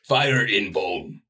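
very slow caveman grunt. Pretend. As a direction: drag it out like a caveman. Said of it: "That is literally the lyric".

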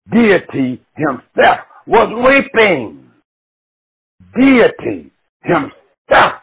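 slow, high Deity himself; slow emphasis. Real, not acted. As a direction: lean hard into Deity himself. Preacher building on deity weeping.

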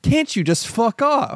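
high, slow exasperated plea; drawn-out mock exasperation. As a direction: act out being utterly fed up. Retelling a fed-up film character.

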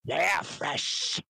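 high, very slow hungry orc. Acted. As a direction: savour it slowly as a greedy orc. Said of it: "I can do a really good orc".

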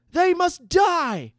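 very high, loud, drawn-out shout; young mage shouting. A narrator's reading. Young mage full of hatred urges execution, shouting high. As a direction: shout the death sentence with youthful hatred. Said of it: "shouted a young mage".

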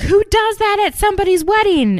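very high, loud indignant squeal; over-the-top outraged housewife; outraged shriek. Retold or imitated. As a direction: shriek it like a scandalized guest. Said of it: "And Danielle's like".

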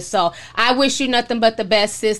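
ordinary talk

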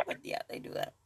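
unvoiced whisper, trailing off; whispered aside. Real, not acted. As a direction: breathe it out as a private aside. Trailing aside on people's habits.

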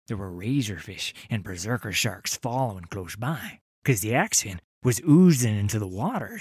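ordinary talk

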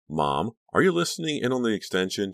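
pointedly loud, lifted pitch; loud challenge. A narrator's reading. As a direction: call out to an eavesdropping mother. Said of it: "she asked loudly".

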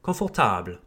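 French confortable as "kon-for-TABL"; low confortable, weight on "table"; stress on final syllable. Shown as a demonstration. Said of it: "En français".

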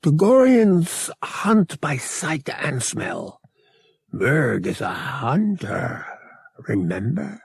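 slow, deliberate alien hunter; calm creature voice, unhurried; Togorian hunter voice. A narrator's reading. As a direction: speak like a proud beast who hunts. Voicing Murg the Togorian.

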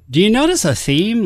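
normal talking voice

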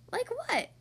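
put-on high, eager child's question; curious question. A narrator's reading. Lola wanting to ask the barber what else, curious. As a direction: pop it up high, wanting to know.

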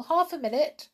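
high, loud call. A narrator's reading. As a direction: hail someone to wait. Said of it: "called the sailor doll".

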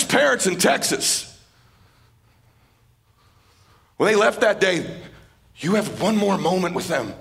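loud, driving motivational preaching; rally the crowd like a revival preacher; preaching at full force. Acted. Speaker urges the event audience to live fully, preaching at full force.

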